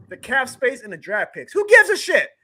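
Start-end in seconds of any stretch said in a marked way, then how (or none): 1.5-2.3 high, loud outburst of disgust; angry shout. Real, not acted. Fan ranting at the media, loud shout. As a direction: yell it, fed up with the critics. Sports rant about cap space.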